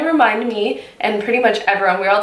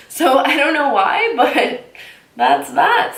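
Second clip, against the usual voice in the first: laughing; 5 semitones higher, slower than usual. Real, not acted. Laughs at nameless shade.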